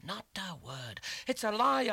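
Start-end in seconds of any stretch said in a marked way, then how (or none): 1.3-1.9 high, loud outburst; a desperate shout, pitched high; crying out. A narrator's reading. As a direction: fling the denial out loud. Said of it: "I cried".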